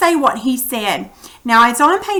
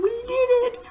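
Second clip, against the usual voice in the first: excited; 13 semitones higher, 7 dB quieter than usual. Retold or imitated. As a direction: cheer the victory. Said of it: "They were probably thinking".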